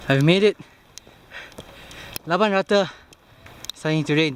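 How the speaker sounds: arrive and cheer it out; excited. Real, not acted. Reaching the hut after climb.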